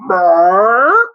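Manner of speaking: loud imitated burp, sliding upward; drawn-out mock belch. A narrator's reading. Boy after a big swig of soda, burping. Narrator acts out Lenny's burp.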